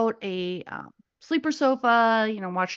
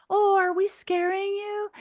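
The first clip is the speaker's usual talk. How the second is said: alien woman; 9 semitones higher than usual. Retold or imitated. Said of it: "she goes".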